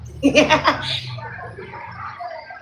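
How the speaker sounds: breathy high laugh trailing off; wheezy laugh. Real, not acted. Laughing at sounding like a man robot, wheezy. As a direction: laugh helplessly at your own joke.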